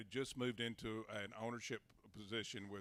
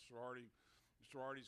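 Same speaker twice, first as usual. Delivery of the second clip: ordinary talk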